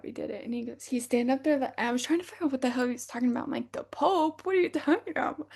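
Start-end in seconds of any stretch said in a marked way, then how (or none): 3.7-5.5 higher, mock disbelief; baffled question. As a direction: act out stunned confusion. Incredulous retort to friend.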